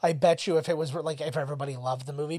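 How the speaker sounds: ordinary talk, nothing marked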